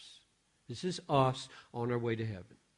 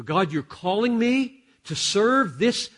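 much higher and louder, slowed, exasperated plea; Moses complaining to God; 9 semitones higher, 11 dB louder than usual. Retold or imitated. Moses crying out to God about his people, loud exasperated plea. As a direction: cry out like a weary leader to God.

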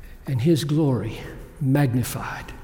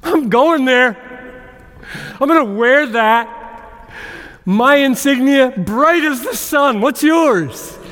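higher and louder, ringing out to the congregation; full-force preaching; 10 semitones higher, 6 dB louder than usual. Real, not acted. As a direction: proclaim it like a rallying cry.

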